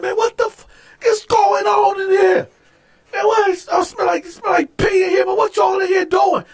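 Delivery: very high, incredulous guard imitation; alarmed outrage. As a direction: act out guards storming in baffled. Guards burst in on fight.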